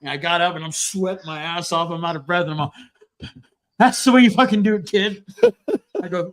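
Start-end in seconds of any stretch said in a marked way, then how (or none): 3.8-5.2 triumphant boast. Retold or imitated.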